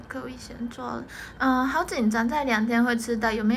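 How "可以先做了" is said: whisper. Real, not acted. Whispered aside on stream.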